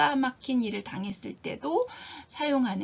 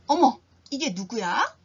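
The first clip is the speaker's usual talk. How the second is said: glad surprise; 6 dB louder than usual. Shown as a demonstration. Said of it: "놀람, 반가움".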